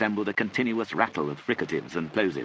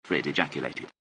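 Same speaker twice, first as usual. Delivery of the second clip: ordinary delivery, nothing marked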